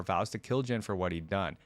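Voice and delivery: usual speaking voice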